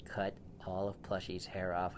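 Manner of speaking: plain reading voice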